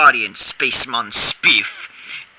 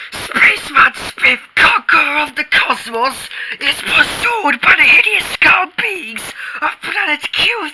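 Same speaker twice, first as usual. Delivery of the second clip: high, breathless pulp-hero announcing; milk the doom for all it's worth; melodramatic narration. Acted. Boy narrates his space hero's doom, high and melodramatic.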